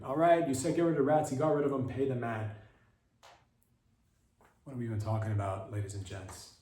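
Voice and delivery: usual speaking voice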